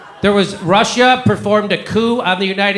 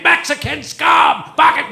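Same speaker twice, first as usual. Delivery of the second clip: slow, drawn-out mock yell, much higher; mock scream; 9 semitones higher, slower than usual. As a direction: ham up the outraged anchor screaming scum. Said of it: "you couldn't say".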